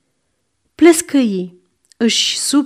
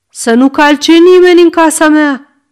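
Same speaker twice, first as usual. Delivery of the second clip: put-on louder, higher shout of a stubborn old man; angry shout; 5 semitones higher, 6 dB louder than usual. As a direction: bark it out as a forbidding order. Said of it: "strigă hagiul". (strigăt furios)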